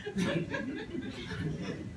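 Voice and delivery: a quick wheezy laugh; breathy laugh. Real, not acted. Laughs after her story point.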